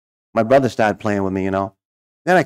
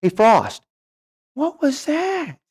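high, slow incredulous coach call; coach yelling; much slower than usual. Retold or imitated. Coach shouting to the pitcher on the mound. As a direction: call out stretched and high in disbelief. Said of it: "my coach yells out to the mound".

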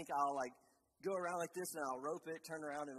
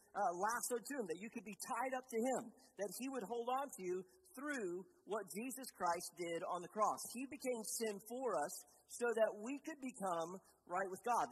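higher, emphatic sermon climax; impassioned preaching; 6 semitones higher, 3 dB louder than usual. Real, not acted. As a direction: drive the message home to the congregation.

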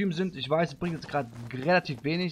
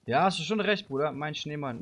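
usual speaking voice

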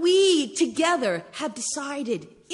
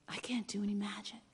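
whispered quote; 3 semitones lower, 14 dB quieter than usual. Retold or imitated. Daughter's sad confession, retold.

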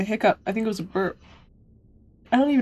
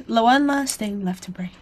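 whispering. Acted. Villain reading taunting line.

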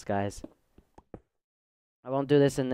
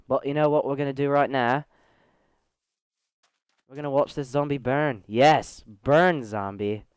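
ordinary talk, nothing marked

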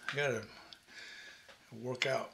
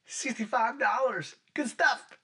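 excited; 8 semitones higher than usual. Real, not acted. Excited about the price.

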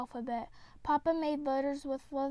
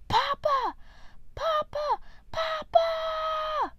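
put-on a child's terrified scream, much higher and louder; screaming for Papa; 6 dB louder, much slower than usual. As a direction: shriek for your father in pain and panic. Child hurt by the awl.

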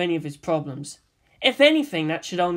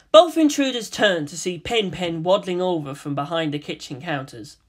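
ordinary delivery

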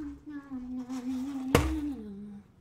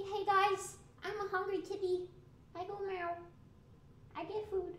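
lightly voiced hungry kitty, higher; voicing the cat; 8 semitones higher than usual. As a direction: speak for the cat as a cute hungry pet.

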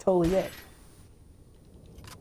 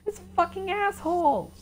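high angry outburst; 12 semitones higher than usual. Real, not acted. Gamer raging at boss.

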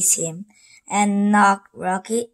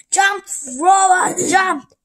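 high, loud order to the robot; villain ordering his robot, high and loud; bossy command; 10 semitones higher, 4 dB louder than usual. Acted.

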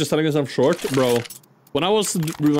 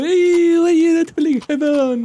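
shout; 13 semitones higher, 5 dB louder than usual. Real, not acted. Shouting at teammate mid-game.

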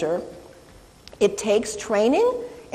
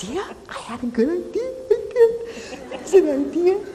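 laughing; 7 semitones higher than usual. Real, not acted. Laughs at her own idea.

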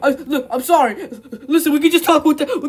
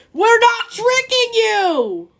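indignant; 8 semitones higher, slower than usual. Acted. Insisting to Peter.

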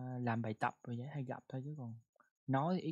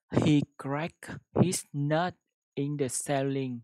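slow careful reading; 8 dB louder, slower than usual. Shown as a demonstration. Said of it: "chuẩn nha các bạn".

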